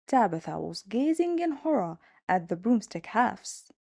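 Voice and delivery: plain reading voice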